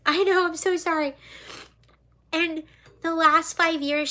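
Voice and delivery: restarting while choked up; tearful apology. Real, not acted. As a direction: through tears. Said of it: "why am I crying".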